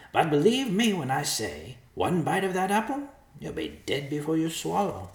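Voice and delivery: high, far-from-narrator vulture voice; warning vulture. A narrator's reading. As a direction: warn them as a high-voiced bird. Vulture warns of the apple.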